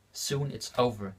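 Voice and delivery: usual speaking voice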